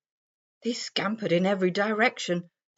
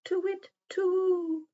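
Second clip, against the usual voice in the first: high, soft owl hooting; 13 semitones higher, 3 dB quieter than usual. Acted. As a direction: hoot it like a little owl. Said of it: "He hooted".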